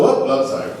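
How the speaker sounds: ordinary delivery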